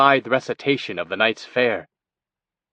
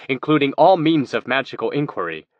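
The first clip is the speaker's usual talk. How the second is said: ordinary delivery, nothing marked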